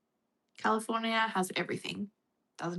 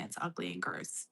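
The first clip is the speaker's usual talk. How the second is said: mock low voice; 14 semitones lower, 10 dB quieter than usual. Mocking a comment.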